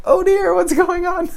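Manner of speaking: very high, loud fluster; mock alarm. Teacher faced with crying student, comically alarmed. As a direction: squeak it out in comic panic.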